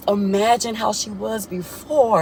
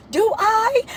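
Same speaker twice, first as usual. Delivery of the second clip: put-on much higher, drawn-out Tennessee country accent; Chelsea's mother in a hammed-up Tennessee country accent; 14 semitones higher than usual. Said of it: "they Tennessee country accent".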